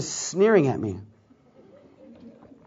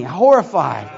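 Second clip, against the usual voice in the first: usual speaking voice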